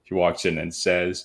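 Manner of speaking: ordinary talk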